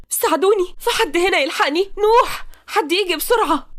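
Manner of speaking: very high, panicked calling; crying for help. Acted. Captive girl calls for help as planned, crying. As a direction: cry out for rescue in staged panic. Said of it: "وهي تعيط".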